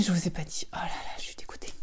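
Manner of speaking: soft fast unvoiced whisper; confiding disappointed whisper; whispered confession. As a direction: whisper it like bad news shared. Confiding a letdown.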